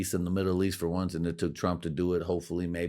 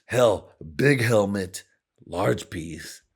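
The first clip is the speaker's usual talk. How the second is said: loud, slow bragging Trump voice; hammed-up boastful president; Trump impression; 3 semitones higher, 5 dB louder, slower than usual. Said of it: "Trump was like".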